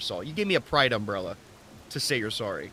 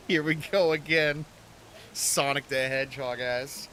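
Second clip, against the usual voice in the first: usual speaking voice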